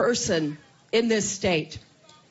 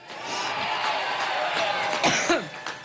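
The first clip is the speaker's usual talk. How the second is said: long hacking coughing fit; chesty cough; 6 semitones lower than usual. Real, not acted. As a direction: cough hard for seconds. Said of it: "she had quite a coughing fit".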